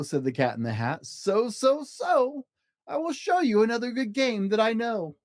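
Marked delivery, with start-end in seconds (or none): 1.1-5.2 higher, carefree; cheeky cat. Acted. Cat to the angry fish, high and cheeky. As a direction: brush the fish off playfully.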